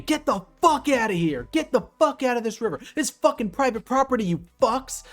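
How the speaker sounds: loud, high-pitched tirade; re-enacted furious yelling, sustained to the last word; imitated angry screaming. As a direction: scream at them to clear off. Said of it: "as this guy is screaming".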